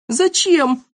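squeaky, high questioning cry; high-pitched protest. A narrator's reading. Boy under bench questions the order, high-voiced. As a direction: call back high from hiding. Frightened boy calls from hiding.

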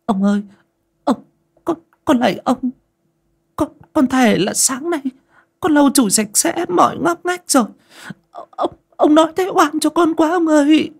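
high, tearful begging voice; pleading sob. Acted. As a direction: plead through tears for mercy. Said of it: "Chị Sen sụt sùi". (giọng van xin nức nở)

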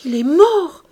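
loud, high anguished shout; old man shouting his grief; terrible cry. A narrator's reading. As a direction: cry it out in horror. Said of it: "cria le vieillard d'une voix terrible".

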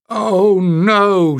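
high, crying wail. Acted. Pen wailing as she sees the boat destroyed, high and crying. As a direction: let it break into a wail. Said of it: "she wailed".

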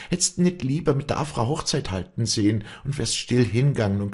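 high, wailing self-reproach; Wally bewailing what she did to him; loud lament. A narrator's reading. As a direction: accuse yourself in anguish. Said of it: "So klagte sie laut".

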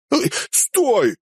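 high-pitched startled cry of Stop; sudden high shout. Acted. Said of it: "вскричал жжёный".

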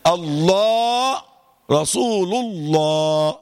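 very slow "الله" with a dark, full lam; long, resonant heavy "الله"; heavy lam exception. Shown as a demonstration. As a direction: thicken and stretch "الله" to a rounded "aw". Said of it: "إلا".

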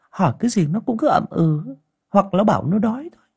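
worried aunt. A narrator's reading. Aunt Liên telling Ngà about the child, worried and hushed.

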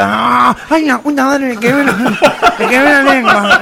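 quejido nasal exagerado; quéjate como gangoso con la lengua quemada; tragafuego gangoso. Acted. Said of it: "que era gangoso también". (gangoso quemado, quejándose)

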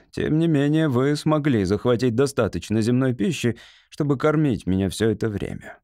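ordinary delivery, nothing marked

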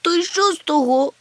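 high, loud tearful retort; whimpering. A narrator's reading. Chmykh refusing comfort, whimpering. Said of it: "хлипнув він".

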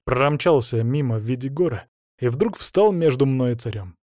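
ordinary delivery, nothing marked

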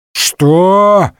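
loud, high, drawn-out indignant question; growled outrage. A narrator's reading. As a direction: roar it in disbelief, stretched out. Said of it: "зарычал Портос". (возмущённый рык)

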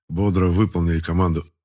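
ordinary delivery, nothing marked

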